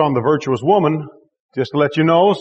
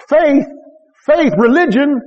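full-force preaching; 7 semitones higher than usual. Acted. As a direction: drive it home to the congregation.

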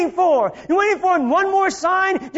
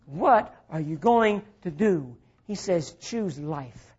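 still small voice; 11 semitones lower, 7 dB quieter than usual. Acted. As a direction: speak as God's quiet inner voice. God's voice speaking softly.

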